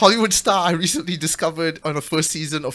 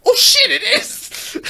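shocked realisation; 5 dB louder, slower than usual. Retold or imitated. Recognising Daisy Ridley on screen.

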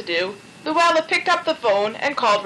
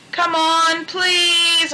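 higher pleading begging; 6 semitones higher, 3 dB louder, much slower than usual. A narrator's reading. Otis begging the pastor to go along, higher pleading. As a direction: plead with him. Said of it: "Otis begged".

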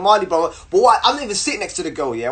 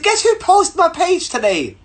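high, eager news-breaking; excited announcement; 12 semitones higher, 3 dB louder than usual. Retold or imitated. Bubbly guy repeating his news to the girl, excited. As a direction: brag it high and keen.

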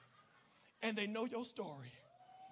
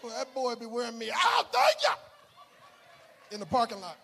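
mock complaint; 12 dB louder than usual. Mimics churchgoers' parking-lot complaint.

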